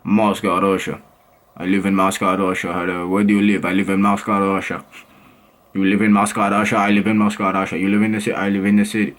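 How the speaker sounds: low-pitched mock Russian accent. Vlogger playing a Moscow local in conversation, Russian accent. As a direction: drop your voice and imitate a Russian accent. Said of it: "this is Moscow. Ready?".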